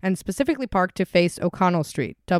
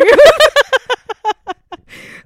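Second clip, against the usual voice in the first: big high shriek of laughter; loud high laugh; 12 dB louder than usual. Real, not acted. Host cracks up at the sing-song quote, loud high laugh. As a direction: laugh helplessly at the friend. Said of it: "host cracks up".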